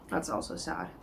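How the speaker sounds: quiet and crestfallen; low, sad murmur. Real, not acted. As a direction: drop low and soft, deflated. Rereading her own annotations.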